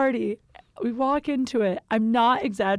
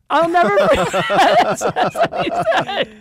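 high, loud helpless laughter through the words; laughing hard; 8 semitones higher, 5 dB louder than usual. Real, not acted. Storyteller recalling the front desk guy's line, laughing hard. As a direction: crack up completely, squeezing the words out.

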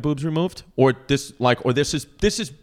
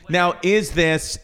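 ordinary talk

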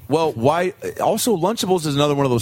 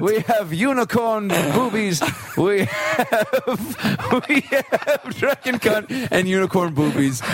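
higher, slow grand menu recital; court servant; 6 semitones higher, slower than usual. Acted. Servant lists dishes, laughing.

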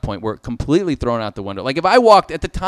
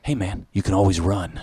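casual stoner drawl; 8 semitones lower, 4 dB quieter than usual. Retold or imitated. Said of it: "He goes".

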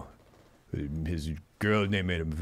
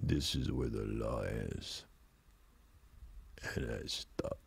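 much lower and softer, slow, fading to a whisper; Cobra voice; 11 semitones lower, 13 dB quieter, slower than usual. Shown as a demonstration. Host redoes the Cobra line for co-host, low slow near-whisper. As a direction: intense movie-hero growl dropped to near silence. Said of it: "Let me do it like I did".

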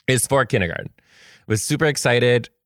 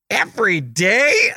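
put-on high, slow puzzled child; little kid voice; 11 semitones higher, slower than usual. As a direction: ask in wonder like a small child. Voicing the child's question.

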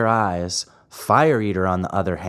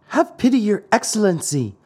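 high, beseeching puppet voice; piteous high-pitched plea; pitiful; 10 semitones higher, faster than usual. A narrator's reading. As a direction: beg the great man for pity. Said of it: "asked in a pitiful voice".

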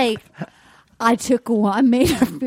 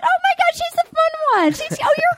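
high-pitched mock gushing; 13 semitones higher, faster than usual. Imitating people calling her the fun one, high mock gushing. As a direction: gush in a much higher imitated voice. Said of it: "It's like, "She's fun".

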